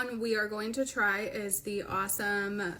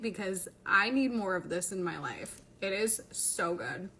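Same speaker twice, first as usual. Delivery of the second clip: normal talking voice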